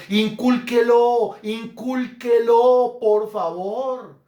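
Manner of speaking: slow, high, loud exhortation; emphatic pleading. Real, not acted. Preacher begging parents to teach generosity, slow loud insistence.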